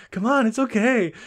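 playfully imitating someone cajoling a shy friend; coaxing. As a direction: coax him along, hammed up. Acting Majima coaxing embarrassed Daigo.

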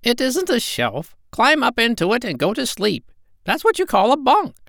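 very high, squawky parrot voice; squawking parrot voice. Acted. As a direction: squawk it out like a bossy old parrot. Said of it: "said the parrot".

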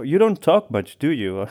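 usual speaking voice

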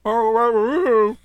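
talking through a mouthful of seltzer; mouth full. Real, not acted. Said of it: "I had seltzer in my mouth".

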